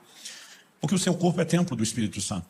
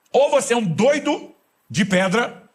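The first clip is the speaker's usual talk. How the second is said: teasing punchline; 10 semitones higher, 5 dB louder, much slower than usual. Acted. Joking punchline.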